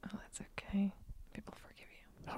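very soft, hushed whisper; whispered reassurance. Real, not acted. Partner comforts host who messes up names, whispering. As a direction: whisper it gently to console.

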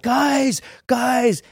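high-pitched alarmed call to friends; freaking out. Retold or imitated. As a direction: plead for their attention in a retold panic. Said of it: "I start freaking out".

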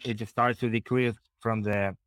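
ordinary talk

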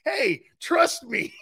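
slow, high, drawn-out parody pledge; mock assurance. Joking about his promise to Frank, drawn-out mock assurance.

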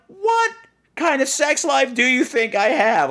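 high, slow disbelieving question; incredulous. Retold or imitated. Retelling to Daniel, a shocked high question.

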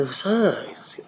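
hushed, breath-only retort; whispered question. Retold or imitated. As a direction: whisper it as a retold aside. Retold question, dropped to a whisper.

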